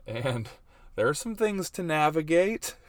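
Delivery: ordinary talk, nothing marked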